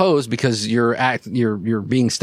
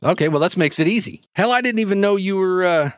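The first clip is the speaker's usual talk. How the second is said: put-on high-pitched, quick talk to an old acquaintance; casual, surprised contact in raised pitch; surprised Jimmy; 6 semitones higher, faster than usual. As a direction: voice a caught-off-guard flight nurse, pitched up. Voices Jimmy surprised at the newcomer.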